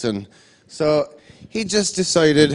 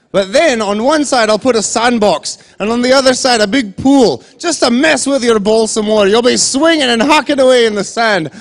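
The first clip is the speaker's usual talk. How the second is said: higher, louder, gloating over the cruel hazards; gleeful excitement; 7 semitones higher, 6 dB louder than usual. Retold or imitated. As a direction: relish every trap like a mad inventor. [name] describing sand and water hazards.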